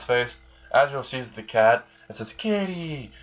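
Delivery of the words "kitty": put-on high-pitched little-kid cry, kept soft; delighted child. Crying kid spots the cat, high excited exclamation. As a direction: imitate a kid's delight, high and small.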